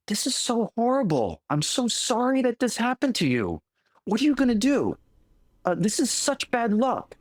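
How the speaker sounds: high, fussy neighbor lamenting the farmer's loss; fretting neighbor. Retold or imitated. Storyteller voicing the neighbors' pity, high and fretful. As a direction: play the worried neighbor, pitch up.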